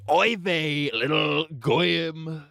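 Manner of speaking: hammed-up Yiddish old man, dragging "goyim"; Yiddish accent.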